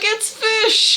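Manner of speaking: high, giddy exclamation; mock-thrilled discovery cry; excited disciples. Acting excited disciples in film.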